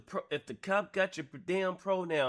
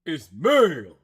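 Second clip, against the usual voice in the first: hammed-up loud echo of the clip; mock loud correction; 11 dB louder than usual. Reactor loudly mimics the clip's insistent correction, mock shout. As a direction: bark it back, louder and mocking.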